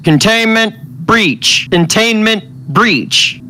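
high, slow alarm broadcast over a failing speaker; crackling intercom voice. A narrator's reading. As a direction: announce it like a broken ship intercom. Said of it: "crackling and barely working".

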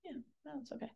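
soft whispered reassurance; whispering. Real, not acted. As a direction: whisper it back, gently hushed. Hushed reply after the outburst.

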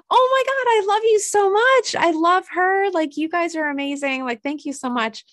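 very high, loud overjoyed gushing; gushing thanks. Retold or imitated. To the salon guy, overjoyed high gushing. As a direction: pour out gratitude in a squeal. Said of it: "I'm like".

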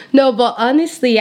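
usual speaking voice

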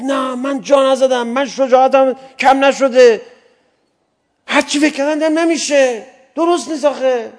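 indignant warrior shouting back; defiant protest. Acted. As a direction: protest loudly that you're no coward. Zubair answers his son's taunt.

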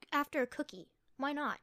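usual speaking voice

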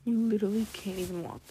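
usual speaking voice

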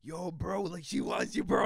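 loud, high hype-man friend; mock excited bro. Quoting his excited friend.

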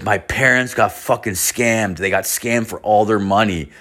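ordinary talk, nothing marked

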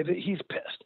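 hushed, breathy whisper on the word pissed; whispered aside. Real, not acted. Confiding about his hurt friend in a whisper. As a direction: drop to a whisper as if it's a secret. Describing Neil's silent anger.

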